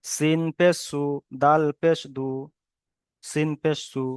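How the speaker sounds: measured syllable-by-syllable spelling drill; spelling recitation. Shown as a demonstration. Teacher shows students how to read joined letters, measured spelling recitation. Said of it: "কেমন ভাবে পড়তে হবে".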